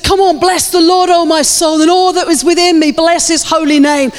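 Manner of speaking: high, fervent declamation of a psalm; impassioned preacher quoting David; rousing self-exhortation. Retold or imitated. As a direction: stir your own soul to praise. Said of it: "David shouted to himself every day".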